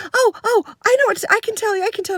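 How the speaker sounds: high, rushed imitation of a keen kid; eager child butting in. A girl cutting in to tell the teacher, eager and high. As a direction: pipe up urgently like a child desperate to tell.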